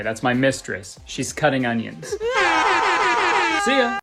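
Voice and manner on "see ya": high shouted goodbye; shouted sign-off. Pretend. Vlogger shouting bye to viewers, high. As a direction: yell the outro.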